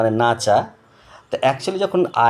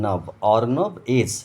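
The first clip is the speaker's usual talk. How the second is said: slow syllables; slower than usual. Shown as a demonstration. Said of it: "বানানটা শেখার জন্য বলছি".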